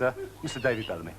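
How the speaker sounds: usual speaking voice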